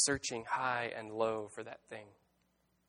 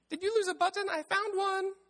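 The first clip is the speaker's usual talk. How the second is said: very high, louder little bird voice; sparrow; 5 dB louder than usual. A narrator's reading. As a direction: pipe up loudly as a tiny sparrow. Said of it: "said the sparrow".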